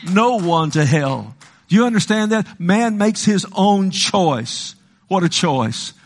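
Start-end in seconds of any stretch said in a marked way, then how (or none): none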